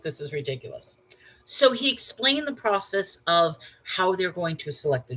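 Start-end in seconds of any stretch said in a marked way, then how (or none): 0.0-1.0 thinking to oneself. Retold or imitated. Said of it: "I'm thinking to myself".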